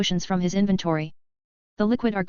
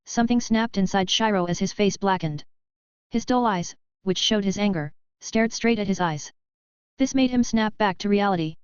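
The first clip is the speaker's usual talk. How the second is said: plain reading voice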